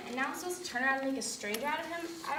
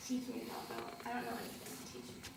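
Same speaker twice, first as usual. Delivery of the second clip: soft plea; 10 dB quieter than usual. Acted. Catherine pleads, intimate, near tears.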